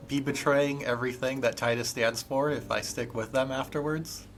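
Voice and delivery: usual speaking voice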